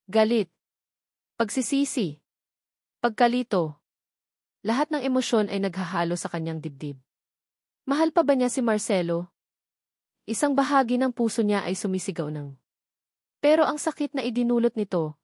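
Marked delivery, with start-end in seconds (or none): none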